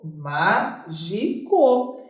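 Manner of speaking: mágico dito bem devagar e grave; very slow word. Shown as a demonstration. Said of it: "Por que que mágico tem acento?". (mágico pronunciado lentamente, em tom grave)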